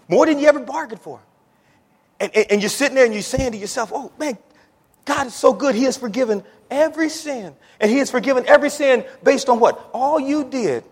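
high-pitched, marvelling exhortation; fervent preaching. Real, not acted. Preacher voicing a believer amazed at forgiveness, high and fervent. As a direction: press the wonder on them, pitched well up.

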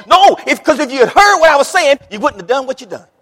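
put-on a father yelling at his boy during a whipping; angry father. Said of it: "what my daddy would say to me".

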